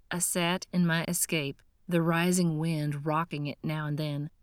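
plain reading voice

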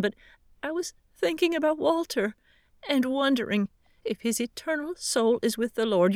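worried widow asking about her husband's soul; tearful older woman. Acted. Pat voiced, tearful after funeral.

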